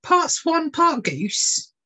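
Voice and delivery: high, eager gasp of disbelief; thrilled, voice jumping up; excited ornithologist. Acted. Ornithologist hearing of a rare bird, excited. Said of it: "excitedly said to himself".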